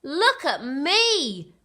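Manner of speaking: high, loud, very slow cry of joy; cried. A narrator's reading. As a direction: shout it out with delight. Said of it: "cried Little Bear".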